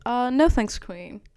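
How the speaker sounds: normal talking voice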